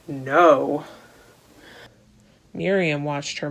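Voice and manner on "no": ordinary delivery, nothing marked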